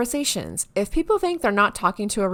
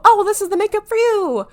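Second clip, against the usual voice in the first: very high, louder gushing sales voice; mock a delighted product recommendation, pitched way up; imagined brand reply; 13 semitones higher, 5 dB louder, faster than usual. Brand recommending makeup to a quiz-taker, high put-on enthusiasm. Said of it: "And you go".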